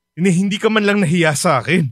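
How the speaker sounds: normal talking voice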